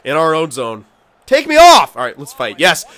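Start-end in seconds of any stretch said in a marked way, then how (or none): 1.2-2.0 high, loud demand; insistent shout. Real, not acted. Player demanding to come off the ice, insistent shout. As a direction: yell it urgently. Demands line change before fight.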